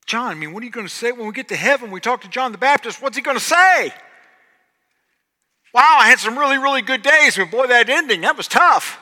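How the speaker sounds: loud, high, fast mock sympathy; hammed-up loud pity for John the Baptist. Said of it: "See, we kinda go".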